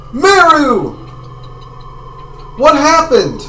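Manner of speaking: high, loud in-character cry of alarm; alarmed call. Acted. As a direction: call out urgently to a fallen friend. Game character calls to fallen Meru.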